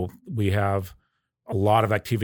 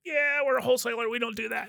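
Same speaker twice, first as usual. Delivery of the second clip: mock reply; 13 semitones higher, 4 dB quieter than usual. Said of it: "We're like".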